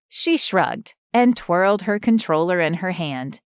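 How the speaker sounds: plain reading voice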